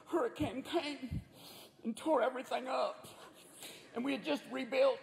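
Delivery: plaintive little victim voice, hammed up; storm-victim family. Family in beach house wreckage telling interviewer, mock mournful. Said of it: "they interview some family standing in the wreckage".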